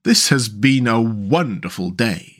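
slow, savouring character voice; Mole. Acted. Mole seated in his first boat, slow contented voice. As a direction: draw it out slowly in a storybook voice. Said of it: "said he".

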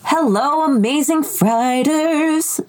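high, slow sing-song greeting; right in your ear. Acted. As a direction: draw out the hello up high. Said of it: "You get my voice in your ear holes".

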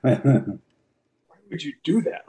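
a disbelieving boss scolding his wrestler; Vince impression. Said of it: "give us an imitation of what he says".

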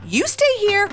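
high, mildly panicked mom; flustered exclamation. A narrator's reading. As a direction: think aloud, hesitating over an excuse. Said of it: "exclaimed her mom".

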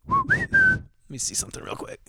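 breathy whisper, voice off; quick private whisper to someone nearby; whispered aside. Real, not acted. Podcast host whispering an aside to someone in the room. Aside off mic mid-story.

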